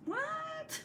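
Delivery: a tiny shocked yelp; squeaky surprise. Real, not acted. Reacts to sister's comment.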